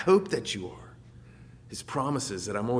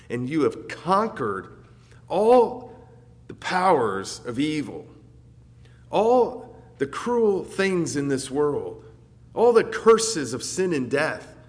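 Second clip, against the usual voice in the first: louder and slower, each phrase weighed as the sermon builds; rising preaching; 4 semitones higher, 6 dB louder, slower than usual. Acted. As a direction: preach it with growing force.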